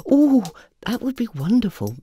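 bursting with delight on the oh; beaming. Acted. Dorothy beaming at the offer of lessons. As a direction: beam with joy. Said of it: "beamed Dorothy".

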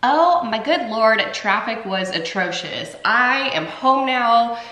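ordinary talk, nothing marked

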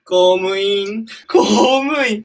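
高く大きな声で、ゆっくり信じられないと繰り返す; 思わず聞き返す驚きの声; 本当にびっくり. Real, not acted. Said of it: "本当に、本当にびっくりしてるよ". (友達の将来の夢に驚いて、高い声で繰り返す)

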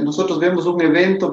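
ordinary talk, nothing marked

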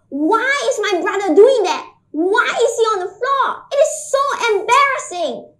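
high, loud exasperated questioning; mounting dismay, pitched high and forceful; acted embarrassed dismay. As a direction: wail it like a mortified older sibling. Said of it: "I was so embarrassed".

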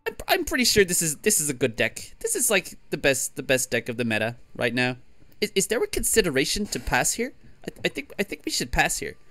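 high-pitched stammering streamer impression; Swim. Player mimics a streamer judging the deck and passing, high hesitant impression. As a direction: jump up high and repeat the first words. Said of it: "that was my Swim impression".

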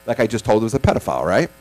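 ordinary delivery

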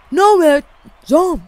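high, loud outraged no mate; loud protest. Real, not acted. Gamer yelling at the game after a bad play, loud outraged protest. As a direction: yell it at the screen in disbelief.

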